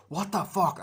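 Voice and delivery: loud, high mock outrage; acted exasperated yell; retold angry yell. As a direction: act out an appalled shout at her. Acts out man confronting the woman.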